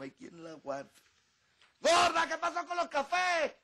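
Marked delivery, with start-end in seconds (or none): 1.8-3.5 bellowing high and loud across the house; shouting for coffee. Acted. Bedridden husband yelling at his wife about coffee, full shout. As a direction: yell for your wife from the couch. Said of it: "Botero, deja los gritos".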